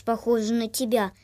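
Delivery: plain reading voice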